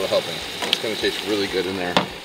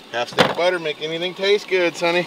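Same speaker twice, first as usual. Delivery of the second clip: mock folksy mom voice, pitched higher; lightly hammed-up mother's advice; a higher, teasing impression of his mother; imitating Mama; 7 semitones higher than usual. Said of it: "Like Mama says".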